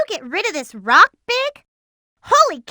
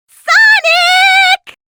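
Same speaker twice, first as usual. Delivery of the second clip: shout; 14 semitones higher, 10 dB louder than usual. Acted. Calling after runaway Sonic.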